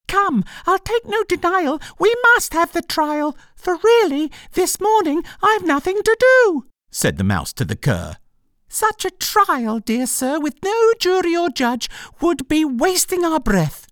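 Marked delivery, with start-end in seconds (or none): 0.1-6.7 very high mouse squeak; tiny pleading mouse; squeaky mouse voice. A narrator's reading. 8.7-13.9 very high mouse squeak; tiny pleading mouse; squeaky mouse voice. A narrator's reading.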